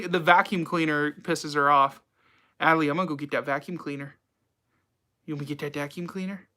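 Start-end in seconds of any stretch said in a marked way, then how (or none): 2.6-6.5 fast, soft coaxing to a pet; coaxing the dog. Acted.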